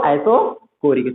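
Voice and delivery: "isocoric" drawn out, with a hard k; correct pronunciation. Shown as a demonstration. Teacher drills the term, slow "isocoric" with a k. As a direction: slowly say "isocoric" as eye-so-KO-rik. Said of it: "मतलब क्या है वो?".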